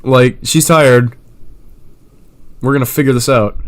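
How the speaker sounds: loud, sneering fake politeness; mock corporate brush-off. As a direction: sneer it out as a rude boss. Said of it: "this is basically corporate talk for".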